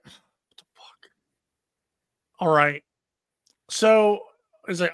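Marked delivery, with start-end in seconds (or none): 0.0-1.1 whispered, slow disbelief; stunned whisper. Retold or imitated. Friend hearing the plan, whispering in disbelief. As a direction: breathe it out in shock. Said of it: "So he was like".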